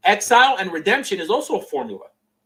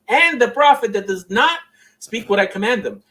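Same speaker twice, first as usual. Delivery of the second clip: emphatic scripture quote; 5 semitones higher, 6 dB louder than usual. Acted. Streamer quoting God's command about prophets, loud emphatic declamation.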